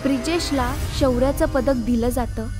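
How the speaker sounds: ordinary delivery, nothing marked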